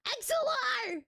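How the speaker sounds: high shout across the room; yelling across the room. Real, not acted. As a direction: call out sharply.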